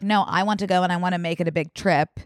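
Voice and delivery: usual speaking voice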